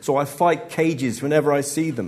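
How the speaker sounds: loud, high bellow. Acted. Comic roaring at the heckling crowd, bellowing. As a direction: roar it at the hecklers. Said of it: "he bellows".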